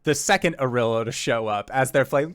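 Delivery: ordinary talk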